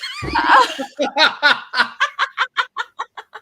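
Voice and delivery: big shrieking burst of laughter; real loud laugh; loud high laugh. Real, not acted. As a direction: let out a loud whooping laugh. Big laugh after the joke.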